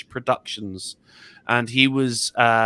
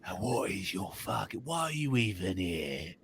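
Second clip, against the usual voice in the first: smoker mother; 7 semitones lower, 5 dB quieter than usual. Retold or imitated. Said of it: "you gotta sound like you smoke twenty cigarettes a day".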